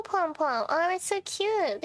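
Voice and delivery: ordinary delivery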